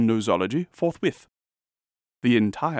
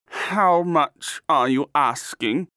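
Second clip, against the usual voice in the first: slow, raised-pitch character voice; inquiring artist; 5 semitones higher, 4 dB louder, slower than usual. A narrator's reading. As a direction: draw the question out with keen interest. Artist haggles over the nose.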